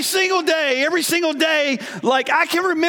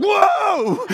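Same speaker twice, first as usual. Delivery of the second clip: startled whoa; 7 semitones higher than usual. Retold or imitated. Dad recalling his daughter flooring the car, a startled high whoa. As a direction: yelp it as the car lurches off.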